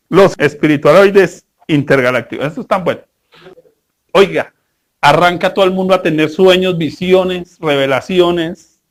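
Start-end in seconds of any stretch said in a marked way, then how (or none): none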